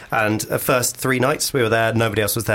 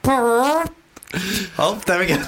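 higher, airy relief; relieved sigh voice; 8 semitones higher, much slower than usual. Pretend. Acting relief at urinal.

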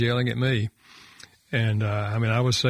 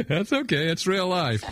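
higher-pitched, laughing reply; amused reassurance; 8 semitones higher than usual. Real, not acted. Guest excusing a dog bark to the others, amused. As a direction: laugh off the interruption.

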